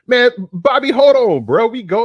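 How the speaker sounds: high, loud burst to cut him off; hyped up, shouting hold on; excited shout. Real, not acted. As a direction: yell over him to take the floor. Host cuts in on co-host.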